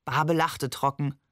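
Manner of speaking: ordinary delivery, nothing marked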